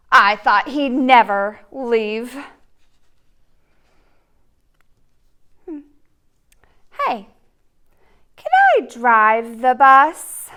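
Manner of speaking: loud, whiny pigeon begging; the pigeon begs and pesters the reader; pleading pigeon. Acted. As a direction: wheedle like a pushy little bird.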